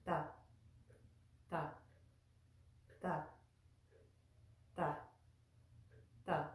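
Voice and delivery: ta with the tongue at the back of the front teeth; soft ta, tongue drawn away; dental ta. Shown as a demonstration. Teacher demonstrating the dental t. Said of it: "placing our tongue at the back of our front teeth".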